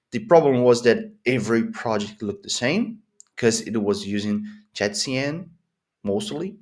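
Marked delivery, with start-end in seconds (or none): none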